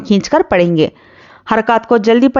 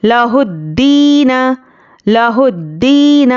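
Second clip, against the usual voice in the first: slow model reading; much slower than usual. Shown as a demonstration. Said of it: "teacher models the phrase".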